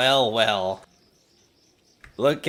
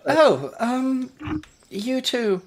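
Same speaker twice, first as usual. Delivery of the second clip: much higher bashful voice; flustered reply; 12 semitones higher, much slower than usual. Acted. Elizabeth answers the dwarf's compliment, high and flustered. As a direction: squeak it out flustered. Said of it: "And he says that and go".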